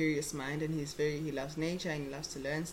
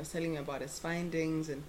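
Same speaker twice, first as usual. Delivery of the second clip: normal talking voice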